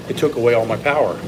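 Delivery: usual speaking voice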